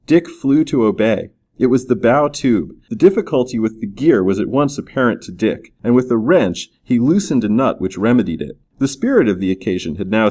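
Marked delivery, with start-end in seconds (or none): none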